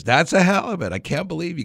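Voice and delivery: high, amazed exclamation fading out; can't believe his eyes. Retold or imitated. Governor amazed at the halibut.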